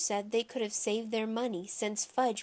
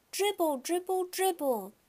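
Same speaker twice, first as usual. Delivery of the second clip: put-on high toddler chant; excited small-child call; little kid hollering; 9 semitones higher than usual. A narrator's reading. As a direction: holler it over and over, pitched up. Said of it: "Fudge hollered".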